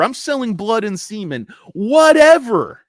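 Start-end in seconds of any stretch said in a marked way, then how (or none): 1.7-2.8 loud, high, slow whatever; drawn-out mock shout. Trader bragging he risks everything, slow loud shout. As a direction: stretch it out and shrug it off loudly.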